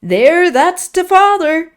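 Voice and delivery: put-on high, loud, slow old man's crow; crowing cry. A narrator's reading. Joseph delighting in Linton's rage, high loud crowing. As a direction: gloat out loud like a cackling old man. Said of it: "he cried".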